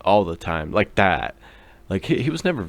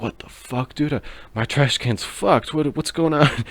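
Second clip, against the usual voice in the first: confused disbelief; 8 semitones higher than usual. Retold or imitated. Waking up confused on floor.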